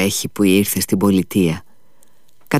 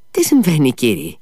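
alarmed cry; 5 semitones higher than usual. A narrator's reading. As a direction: blurt it out in alarm. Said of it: "πρόφερε ο Μήτια".

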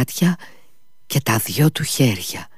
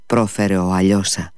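ordinary delivery, nothing marked

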